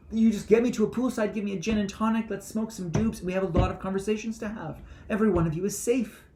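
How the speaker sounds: high, loud jolly Buddha voice; laughing Buddha. Acted. Speaker plays the laughing Buddha at a poolside, high and jolly. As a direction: play a giggling carefree Buddha.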